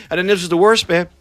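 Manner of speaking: ordinary delivery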